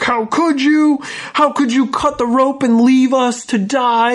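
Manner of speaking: high-pitched wailing accusation, acted out; mock crying outburst. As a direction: imitate a distraught wife crying out in accusation. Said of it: "she gets up and she's crying".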